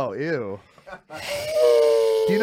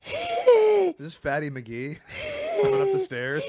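slow high drawn-out character voice; Fatty McGee voice; 12 semitones higher than usual. Retold or imitated. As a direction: channel a goofy Sandler character. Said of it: "the Sandler sketch, Fatty McGee".

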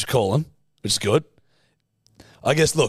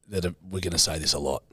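ordinary talk, nothing marked

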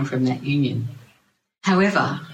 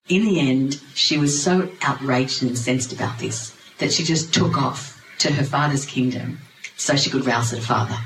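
plain reading voice

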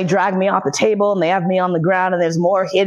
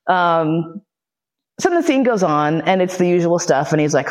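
ordinary delivery

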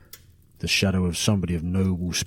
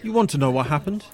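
ordinary talk

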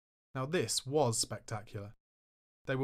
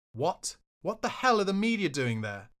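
sharp, louder disbelieving outburst; angry outburst; 7 semitones higher, 5 dB louder than usual. Retold or imitated. To Charlie over the stranded media, angry outburst. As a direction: demand it, higher and louder, in disbelief. Furious at media left waiting.

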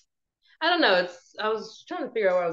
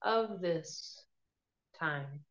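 guided meditation; 5 dB quieter than usual. Acted. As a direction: ease them gently into calm.

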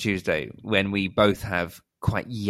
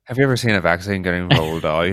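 louder Canadian attempt breaking into a laugh; mock Canadian accent; 2 semitones lower, 5 dB louder than usual. Shown as a demonstration. Trading accent attempts with a co-host, mock Canadian accent. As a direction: have another go at Canadian, laughing on "rolled". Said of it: "My Canadian accent needs some work".

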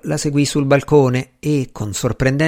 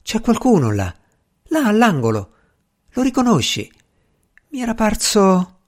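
startled; 9 semitones higher than usual. A narrator's reading. Flora startled, points at street.

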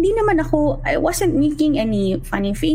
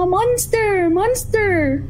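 put-on high, scared child crying out; frightened child; 8 semitones higher, much slower than usual. Toddler pointing at the dark toilet, crying out in fright. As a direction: cry it like a terrified toddler pointing. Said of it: "Sabi niya".

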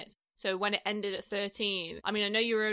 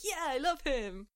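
gushing; 6 semitones higher than usual. Retold or imitated. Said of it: "I was like".

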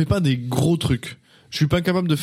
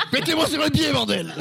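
high-pitched mimicry through laughter; laughing imitation; 12 semitones higher than usual. Host mimics the guest's plea, laughing high. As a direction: parrot the line while cracking up.

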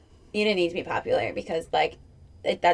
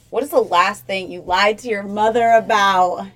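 usual speaking voice

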